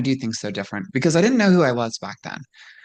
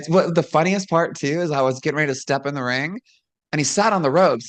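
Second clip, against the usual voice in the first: usual speaking voice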